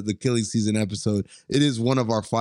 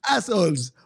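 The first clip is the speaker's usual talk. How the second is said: high-pitched mock accent impression; comic accented character voice, pitched up; Maurice; 9 semitones higher than usual. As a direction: curse everyone in a put-on foreign accent. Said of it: "Maurice from Tiptoes".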